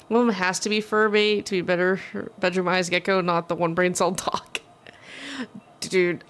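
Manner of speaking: usual speaking voice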